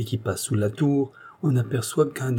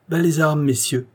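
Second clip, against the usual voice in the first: higher-pitched order to disarm; cried command; 6 semitones higher than usual. A narrator's reading. Commander orders armed men down, crying out. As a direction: call out with authority. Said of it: "s'écria Annonay".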